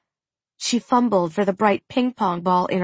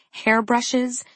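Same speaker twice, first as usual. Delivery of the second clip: ordinary delivery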